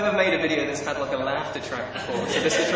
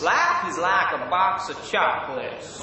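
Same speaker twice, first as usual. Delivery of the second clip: American accent. Shown as a demonstration. Brit quoting film line, Southern American accent. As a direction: draw out the vowels, Southern American. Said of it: "my best American accent".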